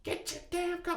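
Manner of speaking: very high mom voice, held back in volume; mock yell, high and contained; imitated yelling mom. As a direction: squawk the order like an irate mother. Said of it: "only time she's ever yelled".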